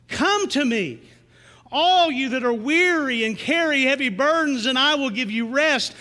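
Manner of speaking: preaching the verse with force; lifted, preached scripture; scripture reading. Acted. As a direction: proclaim the words of Jesus.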